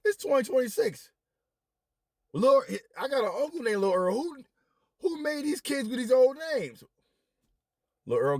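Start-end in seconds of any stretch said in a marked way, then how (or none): 0.0-6.8 high, loud, disbelieving rant; high-pitched louder rising disbelief; incredulous outburst. Retold or imitated. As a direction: rant in shock at the old names. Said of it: "And I'm like".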